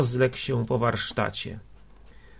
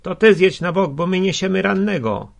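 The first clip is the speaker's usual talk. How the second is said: loud, high, defiant retort; indignant shout back; 7 semitones higher, 7 dB louder than usual. A narrator's reading. Bearers of the wounded man to the coachman, indignant shout. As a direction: shout back, refusing to give way.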